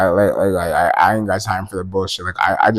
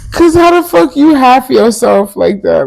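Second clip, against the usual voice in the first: much higher, louder disbelief; incredulous rant; 10 dB louder than usual. Real, not acted. Vlogger ranting to viewers about a man, high and disbelieving. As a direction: demand it in outraged disbelief.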